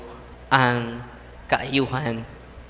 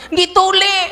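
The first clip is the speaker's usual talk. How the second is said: much higher, loud answer; high child voice; 14 semitones higher, 7 dB louder than usual. Acted. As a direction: squeak it like a small boy.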